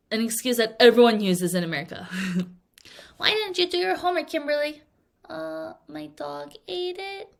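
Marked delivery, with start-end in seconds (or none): 3.2-4.8 higher, fast mock teacher demanding an answer; scolding teacher. Teacher voiced scolding student. 5.3-7.3 slow, soft, higher sheepish mumble; sheepish excuse. Pretend. Student giving lame excuse.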